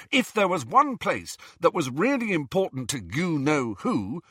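worked-up argument just short of shouting; goaded, exasperated. A narrator's reading. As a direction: press the point with fraying patience. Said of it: "goaded past endurance".

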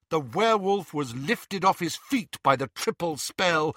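usual speaking voice

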